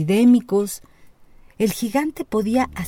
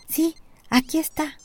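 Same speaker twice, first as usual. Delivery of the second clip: trembling little robot; 9 semitones higher than usual. A narrator's reading. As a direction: squeak it out like a scared tiny robot. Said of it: "tembló el pequeño robotito sirviente".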